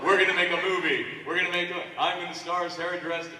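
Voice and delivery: loud, fast, laughing boast; excited. Retold or imitated. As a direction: blurt it out giddy and eager. Recalls his eager movie daydream.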